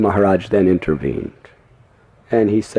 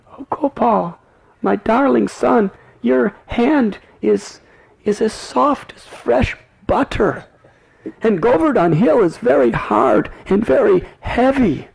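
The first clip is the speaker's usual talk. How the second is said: loving mother; 13 semitones higher than usual. Acted. Yashoda tenderly speaks to Krishna, high loving voice. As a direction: coo tenderly over her son's hand. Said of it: "very lovingly touched Krishna's hand".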